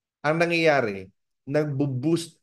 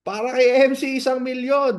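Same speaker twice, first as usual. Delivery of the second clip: higher, louder cheer; cheering call; 9 semitones higher, 4 dB louder than usual. Retold or imitated. Friends in the street cheering the speaker, loud. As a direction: hail him like a champion.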